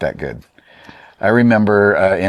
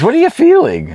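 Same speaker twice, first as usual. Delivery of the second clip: teacher's question, much higher and louder; high quote; 11 dB louder than usual. Retold or imitated. Art student quoting his teacher's question, high and loud. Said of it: "he goes".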